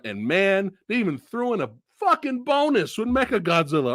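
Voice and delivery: usual speaking voice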